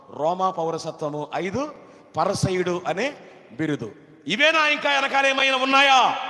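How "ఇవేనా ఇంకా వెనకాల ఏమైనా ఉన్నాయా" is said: high, loud rhetorical question; sudden preaching shout, then easing off; shouted preacher's question. Real, not acted. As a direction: fire the question at the congregation.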